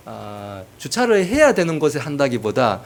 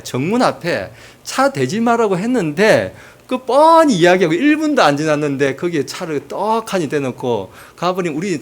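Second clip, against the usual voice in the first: angry shopkeeper; 4 semitones higher, 4 dB louder than usual. Retold or imitated. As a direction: scold the driver who blocked the shop door. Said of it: "이런 전화도 이렇게 오고".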